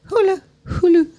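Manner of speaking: high, playful sing-song on Hulu. Pretend. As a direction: toss the word Hulu up high, teasing.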